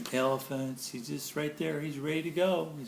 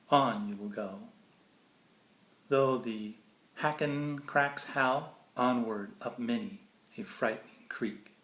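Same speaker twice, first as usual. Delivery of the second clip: bright, lilting storybook read; sing-song picture book reading; reading to children; 4 semitones lower, 5 dB louder than usual. Acted. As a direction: read it like bedtime verse for a child. Reads Dr. Seuss to kids.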